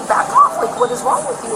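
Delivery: high-pitched retold plea to back off; agitated, high retelling of a protest; retold alarmed protest. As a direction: reenact warning someone off, voice jumping up. Retells warning off the aggressors.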